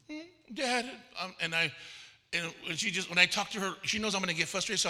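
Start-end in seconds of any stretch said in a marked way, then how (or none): 0.1-0.9 lightly voiced, higher daughter's whine; pouting daughter. Daughter appealing to her dad, mock pout. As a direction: imitate a pouty child, higher. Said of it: "my daughter does that face".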